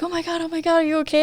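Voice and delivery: imitated panicked friend, high and hushed; freaking out. Friend sees bloody face and asks, imitated freaking out. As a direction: voice a friend's alarm, high-pitched. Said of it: "She's, like, freaking out".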